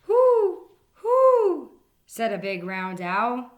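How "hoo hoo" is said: high, loud hooting owl. Acted. Big round owl hooting at the yawning rabbit, high hoot. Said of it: "hooted the owl".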